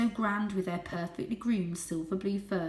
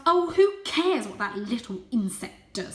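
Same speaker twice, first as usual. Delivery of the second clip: louder, dismissive sneer; scornful cousin; 4 semitones higher, 7 dB louder than usual. A narrator's reading. Julius sneers at Varjak, scornful. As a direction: brush him off. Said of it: "said Julius".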